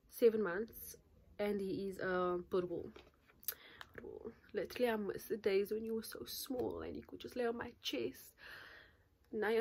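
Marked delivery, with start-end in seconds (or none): 3.9-4.3 whispered aside. Real, not acted. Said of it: "whispered repeat".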